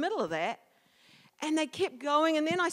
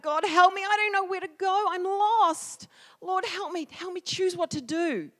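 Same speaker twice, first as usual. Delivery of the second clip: raised, loud pleading; crying out to God; 7 semitones higher, 5 dB louder than usual. Retold or imitated. Lost believer begs the Lord for help, crying out. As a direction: plead upward, high and urgent. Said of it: "I'm yelling out".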